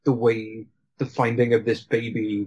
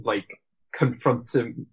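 normal talking voice

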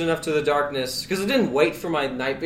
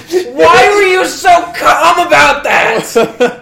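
incredulous shout; 11 semitones higher, 11 dB louder, much slower than usual. Retold or imitated. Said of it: "And I'm like".

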